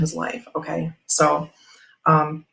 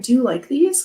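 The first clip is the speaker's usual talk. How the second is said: bright question; 8 semitones higher than usual. Retold or imitated. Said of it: "I'm like".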